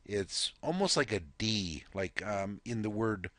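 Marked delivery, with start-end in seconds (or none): none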